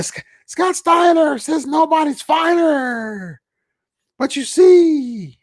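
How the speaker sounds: high, slow, shouted wrestler promo voice; over-the-top Scott Steiner shout; Scott Steiner impression. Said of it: "impersonation there".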